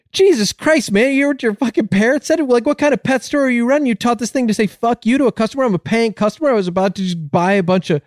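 high-pitched outraged customer; very upset. Acted. Upset customer to pet store owner about parrot, high indignant rant. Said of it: "the guy gets very upset".

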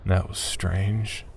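ordinary delivery

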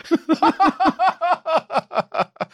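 a high, squealing laugh; high-pitched laugh. Real, not acted. Big laugh at joke.

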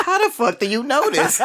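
high, laughing disbelief; shocked and laughing at once; incredulous. Retold or imitated. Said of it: "I'm like".

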